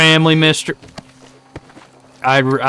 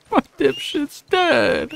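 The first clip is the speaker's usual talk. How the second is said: mock wailing; 11 semitones higher, much slower than usual. Real, not acted. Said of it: "I'm genuinely so upset right now".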